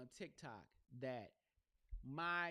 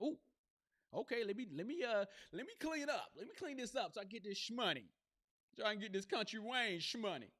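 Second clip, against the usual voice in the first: excited; 7 semitones higher, 4 dB louder than usual. Retold or imitated. Excited self-quote about clean-up.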